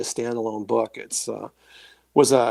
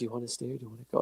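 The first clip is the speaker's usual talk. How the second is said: hushed, quick, benevolent murmur; kind, soft female voice; 13 dB quieter, much faster than usual. Retold or imitated. Kind voice he heard in his ear, softly imitated. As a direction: imitate a gentle voice very quietly. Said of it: "kind, benevolent beautiful female voice".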